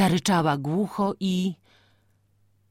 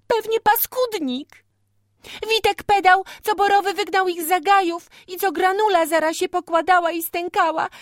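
put-on young girl voice; 5 dB louder than usual. A narrator's reading. Girl explains to father.

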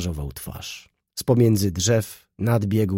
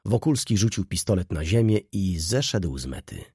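ordinary delivery, nothing marked

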